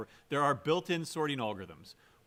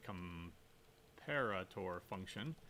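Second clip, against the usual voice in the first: drawn out slowly and much softer; slow, quiet repeat; 7 semitones lower, 12 dB quieter, much slower than usual. Shown as a demonstration. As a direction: say "comparator function" slowly, quietly, for emphasis. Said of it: "A comparator function".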